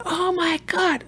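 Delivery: high, startled "oh my God"; sudden fright in a high voice; panicked gasp. Retold or imitated. Sweet best friend losing it over the crack pipe, panicked. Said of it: "loses her fucking mind".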